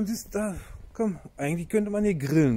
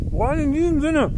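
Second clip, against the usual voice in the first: high loud sign-off; 11 semitones higher, 7 dB louder than usual. Real, not acted.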